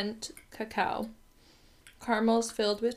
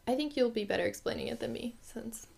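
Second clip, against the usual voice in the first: whispering; 7 dB quieter than usual. Real, not acted. Asides to the viewer mid-explanation.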